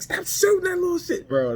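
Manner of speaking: very high put-on voice; high cartoonish gripe; squeaky mock complaint. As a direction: whine it in falsetto. Character complains about pistol.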